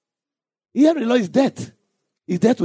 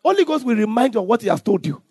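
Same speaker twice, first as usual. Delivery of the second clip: ordinary delivery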